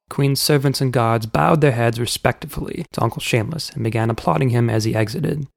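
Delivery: plain reading voice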